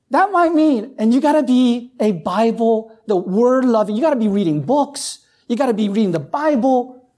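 rising preacher's cry; preaching at full force. Acted. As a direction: lift it and drive it home to the congregation.

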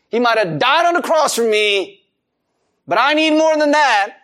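mock ungrateful complaint. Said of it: "you're saying".

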